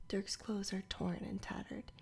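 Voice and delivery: ordinary delivery, nothing marked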